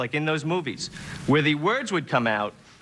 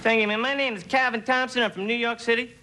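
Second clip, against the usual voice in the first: higher, brash New York hustler pitch; street hustler; 6 semitones higher than usual. Retold or imitated. Auditioning vendor from New York pitching to the boss, brash and high. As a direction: sell it like a street corner hustler. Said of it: "you're first".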